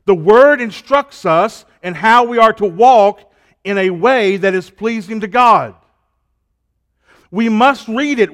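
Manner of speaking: loud, high, ringing pulpit delivery with hammered repetitions; preaching at full force. Acted. Preacher urging the congregation to obey the Word, preaching at full force. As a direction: preach it out, full voice, driving each phrase home.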